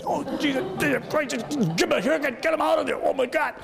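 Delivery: exasperated mom. Retold or imitated. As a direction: squeal it like a mom at her wits' end. Mother exasperated by kid.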